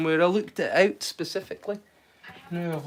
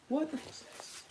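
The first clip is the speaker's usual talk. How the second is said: a hushed, cut-off swear; whispered swear; 8 semitones higher, 18 dB quieter, slower than usual. Real, not acted. Stops himself swearing because Will is here, whispered. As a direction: whisper the curse so it barely gets out. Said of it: "I don't wanna swear".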